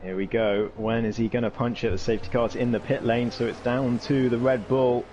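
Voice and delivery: ordinary delivery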